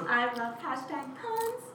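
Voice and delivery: hammy pun delivery; proud groaner of a pun; embarrassing dad joke. Acted. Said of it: "Her bad dad joke voice is coming out".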